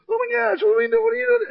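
very high-pitched frantic squeal; hammed-up alarm, voice shooting way up; mock panic. Player sees the pig come out, panicked.

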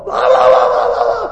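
loud, high blah-blah drowning someone out; mock yelling. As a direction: imitate an angry caller shouting over you. Customer yelling over his reply.

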